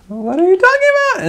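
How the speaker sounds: high, fast, baffled squeak; confused high-pitched squeal. Retold or imitated. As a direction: sound totally lost and confused. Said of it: "And I'm like".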